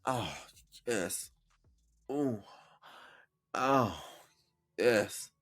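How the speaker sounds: soft, drawn-out pleasure sighs; mock sultry moans. Shown as a demonstration. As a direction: moan it softly like you feel it. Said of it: "You gotta be like".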